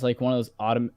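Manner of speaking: ordinary talk, nothing marked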